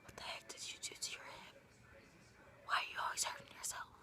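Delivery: hushed scolding whisper. Real, not acted. Friend whispering dismay over an injured hip. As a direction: whisper it in disbelief.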